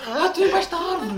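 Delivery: shrieks it high through laughter; laughing outburst; laughing shout. Real, not acted. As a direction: yell the answer while cracking up. Laughing shout of riddle answer.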